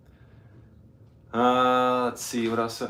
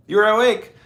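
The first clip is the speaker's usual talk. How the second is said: high bright surprise; suddenly notice the dog woke; surprised; 9 semitones higher, 6 dB louder than usual. Real, not acted. Dog wakes up.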